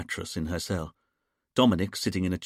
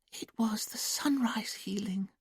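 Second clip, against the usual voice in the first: high, slow, whispered dreamy voice; trance whisper; 12 semitones higher, 5 dB quieter, slower than usual. A narrator's reading. Julia in trance answering Dominic, high childlike whisper. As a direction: breathe it out far away, like a child. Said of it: "quiet, sing-song voice".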